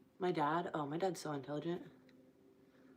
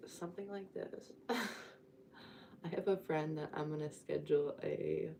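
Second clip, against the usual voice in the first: usual speaking voice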